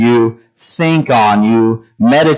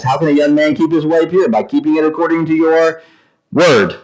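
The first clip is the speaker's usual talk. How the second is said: quick, run-on recitation; fast verse recital, little stress between words; recited from memory; 3 semitones higher, faster than usual. Shown as a demonstration. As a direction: rattle it off as memorized. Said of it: "now I have it memorized".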